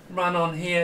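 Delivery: ordinary talk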